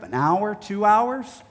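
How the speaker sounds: normal talking voice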